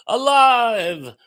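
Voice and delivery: hammed-up Frankenstein triumph; a loud movie-quote yell; mock mad scientist shout. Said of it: "[name] says".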